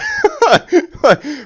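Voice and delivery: high, loud laugh breaking out; real laughter, high and loud; loud burst of laughter. Real, not acted. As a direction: crack up laughing at the absurd line. Laughs at the clause.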